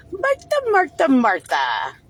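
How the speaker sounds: high sing-song Martha, Martha then dropping; mocking sing-song call. Calling out to Martha scornfully.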